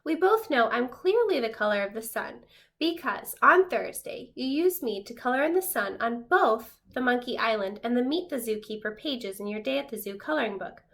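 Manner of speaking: usual speaking voice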